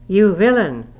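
high, loud shout of fury; outraged cry. A narrator's reading. Nurse rushing furiously at the attacker, high outraged shout. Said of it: "I began to cry".

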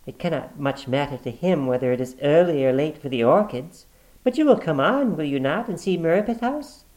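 high, light woman's reply. Acted. Sister covering with her brother, smoothly. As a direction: answer smoothly.

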